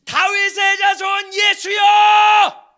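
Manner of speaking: long, slow, high shout over a crowd; desperate shout. Acted. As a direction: cry out to be heard above the crowd. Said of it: "수많은 인파 속에서 어떻게 했어요?". (군중 너머로 길게 외치는 절규)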